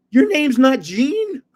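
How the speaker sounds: high, loud, drawn-out disbelief; astonished onlooker. Retold or imitated. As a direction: act out a stunned bystander's question. Astonished onlooker in retold story.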